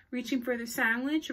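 usual speaking voice

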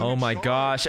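usual speaking voice

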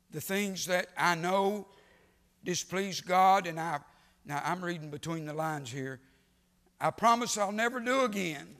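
loud, high preacher's call; a preacher quoting Paul loudly and with fervour; full-force preaching. Acted. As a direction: drive it home to the congregation.

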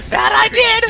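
squealed high and loud; high shout. Acted. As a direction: yell it in a high put-on voice.

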